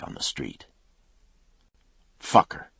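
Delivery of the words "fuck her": a harsh curse said out loud, breathy and forceful; angry curse spoken aloud. Acted. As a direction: spit it out loud at her back. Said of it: "he said aloud".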